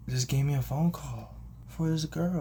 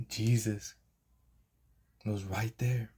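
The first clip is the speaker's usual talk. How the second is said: plain reading voice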